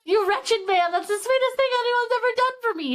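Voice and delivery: high-pitched, delighted mock swoon; happy, touched gushing. Heroine thanking him for hiding books, happily gushing. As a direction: gush over him in an acted-out squeal. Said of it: "It made her happy".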